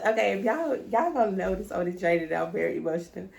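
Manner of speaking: normal talking voice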